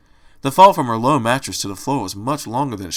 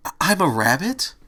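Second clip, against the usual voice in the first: stammered, higher rising wail; 5 semitones higher than usual. A narrator's reading. Fluttershy realizes she is a rabbit, wailing. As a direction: wail it in dismayed disbelief. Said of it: "Fluttershy wailed".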